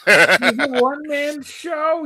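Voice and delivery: slow, high, drawn-out billing; hammed-up announcer; mock show announcer. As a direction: stretch it out like a showman.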